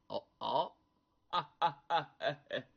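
put-on low, amused old man's hum and laugh; chuckling old man. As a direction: chuckle knowingly, like a sly old uncle. Old uncle amused by visitor.

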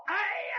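long, high-pitched howl of pain; howl like a slaughtered pig. Acted. Hung-up victim howls in agony, pig-slaughter wail. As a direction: wail "aiya" high and drawn out. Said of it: "像杀猪一样那么嚎".

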